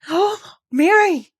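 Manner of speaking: high, loud crying out; half a sob. Acted. Hysterical Colin to Mary, crying with half a sob. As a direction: cry her name half sobbing. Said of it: "he cried out with half a sob".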